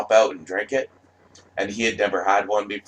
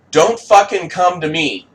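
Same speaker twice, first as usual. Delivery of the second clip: higher and louder, fed up; heated outburst; 8 semitones higher, 6 dB louder than usual. Real, not acted. Telling critics off in a raised, angry voice. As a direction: push them off angrily.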